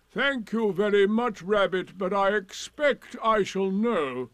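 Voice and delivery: slowing, heavy irony; sarcastic Eeyore. A narrator's reading. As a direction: thank them with wounded dignity. Eeyore answers Rabbit sarcastically.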